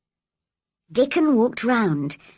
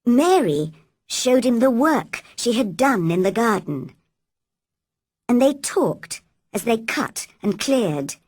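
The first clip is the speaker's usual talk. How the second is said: plain reading voice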